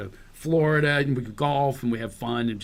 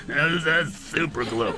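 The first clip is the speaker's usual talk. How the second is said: slow rasp with a whistled S; slow, whistly impersonation; raspy Don Johnson; 2 semitones lower, much slower than usual. Retold or imitated. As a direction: whistle the S in "super", drawn out. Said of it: "he's whistling his ass".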